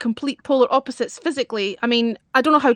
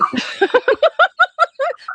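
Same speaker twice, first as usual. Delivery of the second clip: squealing burst of laughter; a high-pitched cackle. Real, not acted. Laughing at teasing.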